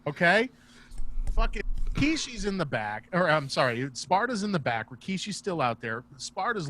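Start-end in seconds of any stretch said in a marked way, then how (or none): none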